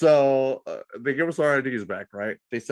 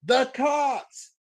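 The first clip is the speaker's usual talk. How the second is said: high yelled warning; drunk yell; 13 semitones higher than usual. Retold or imitated. Drunk friend yelling at the police car. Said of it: "literally yells".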